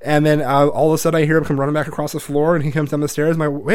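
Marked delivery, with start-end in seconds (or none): none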